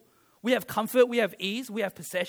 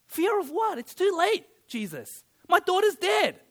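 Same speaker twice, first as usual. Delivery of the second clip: exasperated Jairus; 8 semitones higher than usual. Retold or imitated. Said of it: "Jairus is thinking".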